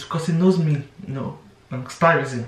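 normal talking voice

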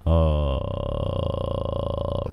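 lowest possible growl of a voice; extremely deep bass voice. Shown as a demonstration. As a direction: drop to the very bottom of your range. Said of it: "一番低い声ってどこまで出んの？".

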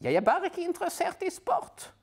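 sniffy dismissal; detective huffing at his wife; detective's voice. Acted. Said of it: "sier Puriot".

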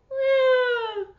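high loud mock wail. Teacher wails for the baby llama, high loud mock wail. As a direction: let out a hammed-up baby wail.

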